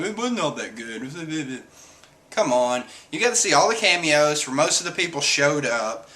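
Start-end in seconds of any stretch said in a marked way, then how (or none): 0.0-1.6 slow, soft mock whine; mocking naysayer. Mocking people who disliked the chapter, slow whiny imitation. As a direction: imitate a grumbler in a drawn-out put-on voice. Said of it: "some people are coming like".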